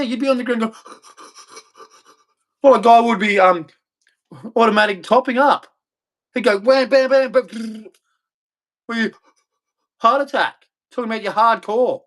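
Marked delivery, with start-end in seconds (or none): 0.0-2.7 high, airless mock gasp. Acts out a fat man's heart attack. 6.6-7.9 high, punchy bam bam bam; mock gun noises. Mimics a rapper's gunfire.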